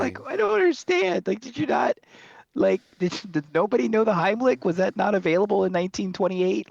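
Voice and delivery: high, baffled disbelief; can't believe how dumb this is; incredulous. Real, not acted. Reacting to the oyster death, high incredulous rant.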